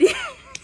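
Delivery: quick high burst of laughter; short high laugh. Real, not acted. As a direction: laugh at the surprise. Laughing at the reveal.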